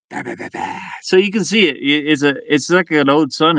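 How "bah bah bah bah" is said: muttered nonsense. Real, not acted. Mumbling to self fixing light.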